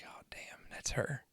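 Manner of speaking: retold under-the-breath whisper; hushed awe. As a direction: whisper it as if to yourself. Retold hushed reaction on hearing her.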